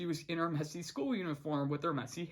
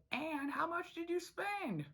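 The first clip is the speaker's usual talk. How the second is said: put-on higher, slow old frog; exasperated grandpa asking; Hop Pop voice; 12 semitones higher, 4 dB quieter than usual. Hop Pop scolding Anne over her spending, exasperated.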